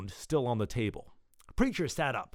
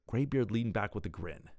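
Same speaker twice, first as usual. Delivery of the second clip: usual speaking voice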